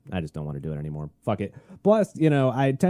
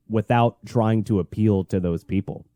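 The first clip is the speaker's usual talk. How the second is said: ordinary talk, nothing marked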